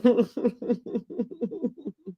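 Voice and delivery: soft, high staccato giggle; light, bubbling titter; real laugh. Real, not acted. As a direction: let a long quiet laugh trail on. Amused at cussed-out remark.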